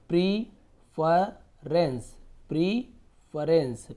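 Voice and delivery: प्रीफरेंस stretched very slowly, syllable by syllable; slow drawn-out pronunciation. Shown as a demonstration. Teacher to students, slowly spelling out प्रीफरेंस. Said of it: "इस प्रकार से प्रेफरेंस".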